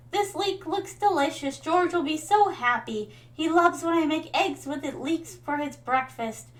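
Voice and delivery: put-on old grandmother thanking the farmer for leeks; Evelyn. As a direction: gush like a grateful grandma over a gift. All right, so it's Evelyn.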